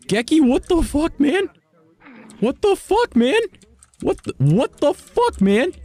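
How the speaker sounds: very high, squeaky shock; overwhelmed disbelief. Real, not acted. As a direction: squeal in disbelief at a generous friend. Reacts to huge donation, overwhelmed.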